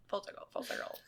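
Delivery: barely voiced, under the breath; hushed, trailing murmur; very quiet. Real, not acted. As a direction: talk softly as if to yourself. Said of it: "You're so quiet".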